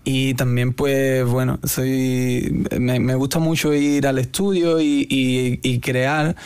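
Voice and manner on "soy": ordinary talk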